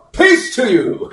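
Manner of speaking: high, loud surprise greeting; loud prank hello. Shown as a demonstration. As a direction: pop out with it to scare them. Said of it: "I assume he said it real loud".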